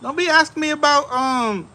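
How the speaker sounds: indignant pushback. Retold or imitated. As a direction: brush off a nosy salesman. Indignant retort to the salesman.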